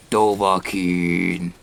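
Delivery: low, slow, raspy drawn-out call; raspy old voice. Retold or imitated. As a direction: intone it like a wise old man. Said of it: "raspily voices say".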